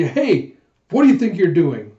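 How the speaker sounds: loud, indignant call-out; angry. Acted. Angry Yun calling up to Huck from the street, angry. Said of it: "you see an angry Yun".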